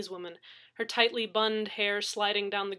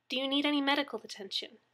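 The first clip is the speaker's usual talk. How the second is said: higher, quick professional question; efficient dispatcher; 5 semitones higher, faster than usual. A narrator's reading. Dispatcher checking on injured victim, efficient. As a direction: ask briskly but kindly.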